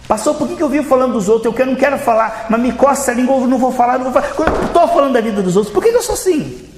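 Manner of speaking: high-pitched churchgoer's complaint, climbing at the end; exasperated gossip confessing. Acted. Fiel confessa fofoca ao pastor. (fiel aflito confessando, em tom agudo)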